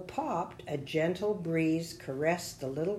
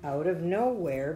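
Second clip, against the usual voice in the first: plain reading voice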